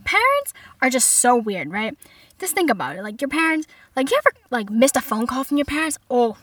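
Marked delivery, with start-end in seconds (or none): 0.0-0.5 high, loud crowd greeting; stand-up comedian onstage. Pretend. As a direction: greet a packed club with hammed-up cheer. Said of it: "picture me as a stand-up comedian".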